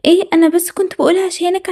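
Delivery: ordinary talk, nothing marked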